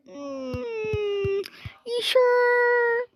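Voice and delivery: high drawn-out acted question; hum, then squeal the question high; squealed doubt. Acted doubtful reply to intruders.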